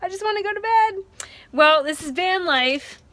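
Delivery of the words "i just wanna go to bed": laughing through tears, higher and much softer, voice cracking toward crying; almost crying but still laughing at the mess; close to tears. Real, not acted. Vanlifer stuck in the sand at midnight, on the edge of real tears. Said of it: "I'm gonna try not to cry".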